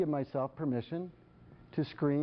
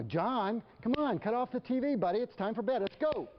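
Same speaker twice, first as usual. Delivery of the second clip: higher, pressing, a little irritated; miffed parent; 9 semitones higher than usual. Retold or imitated. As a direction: call him again, now miffed. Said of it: "now I'm a little bit miffed".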